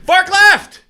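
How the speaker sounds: high, loud yell of forklift; yelled warning. Retold or imitated. Costco worker yelling a warning at shoppers, loud yell. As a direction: belt it out like a warehouse worker. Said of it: "screams at the top of his lungs".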